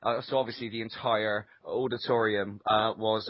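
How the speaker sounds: ordinary talk, nothing marked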